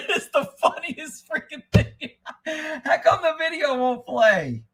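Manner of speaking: high, drawn-out words through laughter; real helpless amusement; laughing while talking. Real, not acted. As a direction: barely get the words out laughing. Laughing at favorite video.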